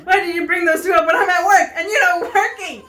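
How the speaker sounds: loud, high, indignant outburst; irritated scolding. Acted. Phyllis snaps at coworker while working, loud and irritated. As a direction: scold with acted annoyance.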